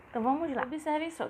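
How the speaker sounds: ordinary talk, nothing marked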